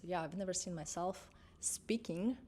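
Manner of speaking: ordinary talk, nothing marked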